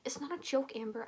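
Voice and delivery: ordinary delivery, nothing marked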